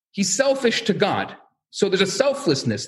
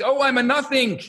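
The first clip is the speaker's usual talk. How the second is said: mock scream; 6 semitones higher than usual. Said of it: "screaming on either end of the study hall".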